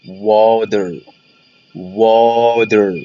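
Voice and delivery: water said as "wader". Shown as a demonstration. Teacher showing first option, water with a D. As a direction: sound the T in water as a clear D. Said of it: "ممكن أنطقها دي أو آه".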